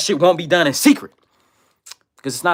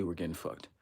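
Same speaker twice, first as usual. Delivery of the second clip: ominous low murmur; 7 semitones lower, 19 dB quieter than usual. Acted. Dramatic refrain about being doomed.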